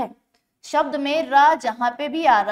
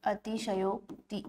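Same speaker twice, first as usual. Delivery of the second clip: अतिशयोक्ति drawn out softly while writing; slow soft dictation; 4 semitones lower, 13 dB quieter, slower than usual. Shown as a demonstration. Teacher writes the word for students, slow and quiet. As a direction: stretch अतिशयोक्ति as you write it on the board. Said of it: "ऐसा लिखा जाएगा".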